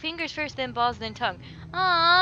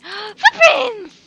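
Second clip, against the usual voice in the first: loud, high delighted exclamation; real excitement with a laugh; excited gasp; 9 semitones higher, 15 dB louder than usual. Real, not acted. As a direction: gasp at the discovery. Excited discovery.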